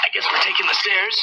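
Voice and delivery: high, dry sarcastic aside; wry resignation. Real, not acted. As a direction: shrug it off with irony. Elevator shaft gone, wry remark.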